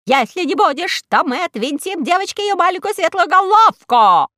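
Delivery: high loud squeak; high loud squeaking threat to Karlo; squeaky general. A narrator's reading. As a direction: squeal the threat like a tiny tyrant. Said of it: "пискнул генералиссимус".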